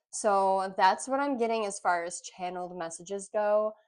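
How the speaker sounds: ordinary talk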